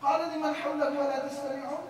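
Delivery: fast chanted verse in Arabic; تلاوة; Quran recitation. Acted. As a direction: recite the ayah.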